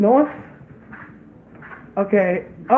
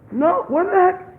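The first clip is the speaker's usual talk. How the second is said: surprised; 10 semitones higher than usual. Real, not acted. Startled at baby.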